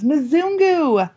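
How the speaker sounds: put-on high little girl call; lightly voiced little girl; child's call.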